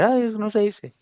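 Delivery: mock scolding. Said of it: "nadie le dice".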